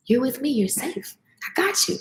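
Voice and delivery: quiet soothing words, the last almost breathed; softly reassuring. Retold or imitated. Daughter soothing her nervous mom in the car, softly reassuring. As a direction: hush a nervous mother, gently. Retold calming of frightened mom.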